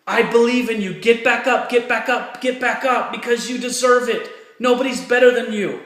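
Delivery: rally the struggling viewer, get them back on their feet; fired up. Real, not acted. Speaker fires up discouraged viewers to keep going, loud and rousing. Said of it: "I get all fired up".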